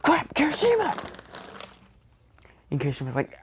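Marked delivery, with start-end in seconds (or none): 0.0-1.1 panicked cry. Acted. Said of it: "he's like".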